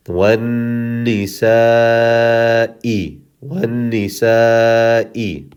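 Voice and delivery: very slow wannisa'i, long nn and longer aa; extended madd. Shown as a demonstration. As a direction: draw out the aa in wannisa'i before the final i. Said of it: "We're gonna extend it a lot".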